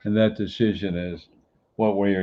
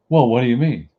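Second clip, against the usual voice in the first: pitched up and louder, a defensive client pushing back; a retold client, higher and louder, questioning sharply; indignant question; 5 semitones higher, 8 dB louder, faster than usual. Client retorts on the phone.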